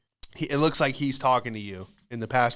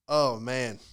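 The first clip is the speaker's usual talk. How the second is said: ordinary delivery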